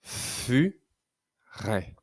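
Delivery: "Furet" in two spaced syllables, drawn-out F. Shown as a demonstration. Teacher helping pupil find the F, "Furet" syllable by syllable. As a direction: hold the F of fu, pause, then ret. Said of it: "entendons-nous le son f".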